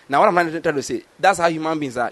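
normal talking voice

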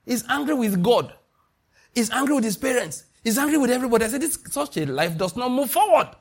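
retold preaching. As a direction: replay the sermon in full cry. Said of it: "I was just preaching".

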